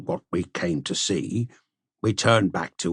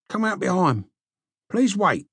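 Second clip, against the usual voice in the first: higher, urgent little servant voice; pleading slave; 9 semitones higher than usual. Acted. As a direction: beg them to stay put. Slave pleading them to wait.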